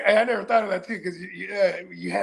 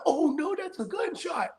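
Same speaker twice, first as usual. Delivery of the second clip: sudden realization; 7 semitones higher, 6 dB quieter than usual. Retold or imitated. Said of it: "Probably till you thought".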